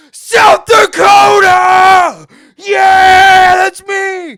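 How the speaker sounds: very loud, high, drawn-out yell; excited shout. Retold or imitated. First to answer the announcer at the reveal, re-enacted excited shout. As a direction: holler it across a crowded hall.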